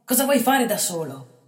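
loud high desperate questions; boy begging the bandit; insistent pleading. A narrator's reading. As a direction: press him in alarm. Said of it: "insiste il ragazzo".

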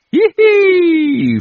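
squittio acutissimo e forte; risatina maliziosa. A narrator's reading. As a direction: ridacchia complice al curato. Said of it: "con un'occhiatina maliziosa". (risatina acuta e maliziosa)

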